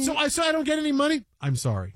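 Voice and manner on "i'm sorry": very low, softer banker brush-off; practiced apology. Retold or imitated. Banker tells client there's no money, deadpan. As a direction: drop deep, rehearsed and deadpan. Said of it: "just like you would say it to somebody".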